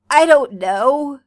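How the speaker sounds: loud, slow snapped "I don't know"; snapping. A narrator's reading. Karkat snapping at worried John, loud and slow. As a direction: bite out each word of "I don't know" defensively. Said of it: "you snap".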